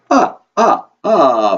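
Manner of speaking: loud, high staccato vampire laugh, falling; triumphant "ah, ah, ah" cackle; Count. Acted. Count delighted with his counting, booming vampire laugh. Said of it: "laugh after everything you say".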